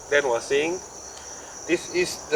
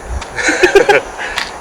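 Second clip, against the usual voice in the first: burst of laughter. Real, not acted. Laughs at joke.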